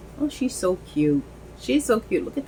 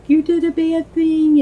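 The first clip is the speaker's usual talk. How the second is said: put-on high, loud child's scolding; upset little sister; 11 semitones higher, 7 dB louder than usual. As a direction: imitate a small kid's accusation, high and loud. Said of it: "she's like".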